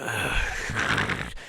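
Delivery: low, quiet drawn-out breathy noise; long wordless exhale, imitating someone passing out; imitated drugged groan. Friend slumps in the bathtub, imitated long fading noise. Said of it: "all I heard was this".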